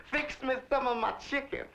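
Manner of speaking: high, fussy lady of the house calling her houseboy; imitating his employer. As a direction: mimic the high-strung madam summoning the servant. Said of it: "she'd enter and say".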